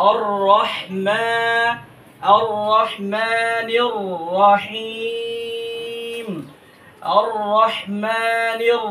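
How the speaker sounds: slow, chanted tajweed recitation; Quran recitation. Shown as a demonstration. Teacher chants the verse for learners, slow and melodic. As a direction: draw each vowel out in a melodic chant.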